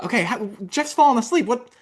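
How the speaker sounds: high, fast, bubbly host voice; rally a crowd of kids; kids' show host. Retold or imitated. Retelling host addressing kids' audience.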